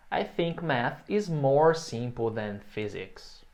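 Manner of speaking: very slow and clear; slow model sentence. Shown as a demonstration. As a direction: model it slowly for repetition. (frase modelo bem lenta)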